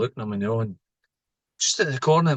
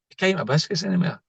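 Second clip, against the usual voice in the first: higher playful tease about the biscuits; mock teasing; 6 semitones higher than usual. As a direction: tease it, pitched up. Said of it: "I went".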